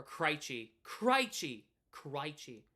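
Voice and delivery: "Krejci" as CRY-chee, repeated mockingly; mispronounced Krejci. Streamer irked by wrong names, mocking CRY-chee for "Krejci". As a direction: say "Krejci" with an "eye" vowel. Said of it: "when people say the name wrong".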